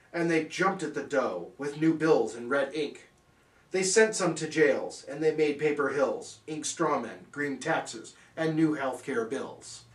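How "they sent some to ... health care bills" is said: normal talking voice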